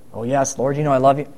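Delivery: low, hushed voice of a retold Peter; subdued reply. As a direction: answer softly and low, as a humbled disciple. Acting Peter answering Jesus.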